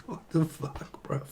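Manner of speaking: usual speaking voice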